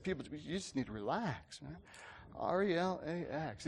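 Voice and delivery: soft, laid-back imitation of a quarterback; relaxed. Quarterback telling worried fans to calm down, relaxed. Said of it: "he said it in such a relaxed way".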